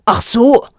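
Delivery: grumbling. A narrator's reading. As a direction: let it rumble out annoyed. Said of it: "grollte Vater Löwenhaupt".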